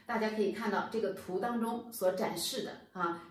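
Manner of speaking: usual speaking voice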